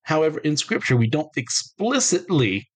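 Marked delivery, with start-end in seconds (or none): 1.0-2.7 explicitly leaned on, higher and drawn out; stress on explicitly. Shown as a demonstration. Teacher stressing a key word to viewers, explicitly weighted and slow. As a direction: hit the word explicitly slowly. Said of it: "I stress that word explicitly".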